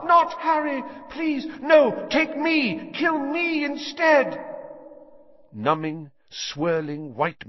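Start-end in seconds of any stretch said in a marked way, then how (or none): 0.0-4.4 high, loud pleading woman's voice; desperate screaming plea. A narrator's reading. A mother begging the killer to spare Harry, desperate screams. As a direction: beg for the child's life, terrified. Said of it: "Someone was screaming".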